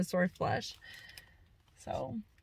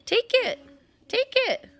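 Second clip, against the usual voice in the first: squeaky high take it; high coaxing to a dog; 14 semitones higher, 4 dB louder than usual. Real, not acted. Urging dog to take treat.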